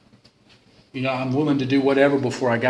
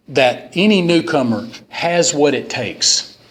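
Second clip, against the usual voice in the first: usual speaking voice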